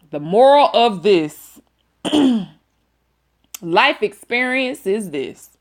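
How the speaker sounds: usual speaking voice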